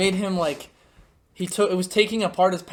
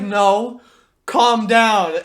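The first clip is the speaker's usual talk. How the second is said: loud, raised, insistent command; mock firm teacher; 4 semitones higher, 12 dB louder, much slower than usual. Teacher overruling Philip's protest, mock firm and loud. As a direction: cut off the protest, imitating a fed-up teacher.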